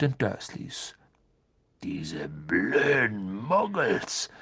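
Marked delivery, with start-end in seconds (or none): 1.8-4.3 very slow, husky grumble; Hagrid grumbling. A narrator's reading. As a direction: drag it out in a giant's grumble. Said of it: "grummelte Hagrid".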